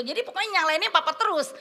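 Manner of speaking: ordinary talk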